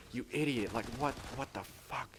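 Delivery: retold annoyance. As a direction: act out being fed up with a brother, without shouting. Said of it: "I'm getting pissed, obviously".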